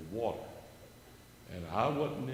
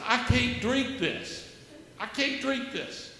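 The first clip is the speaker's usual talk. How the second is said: much higher, slow, pleading; distressed refusal; 11 semitones higher, 6 dB louder, slower than usual. Retold or imitated. Refusing the beer in the Haitian hut, much higher and pleading. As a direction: plead it high and slow, he can't.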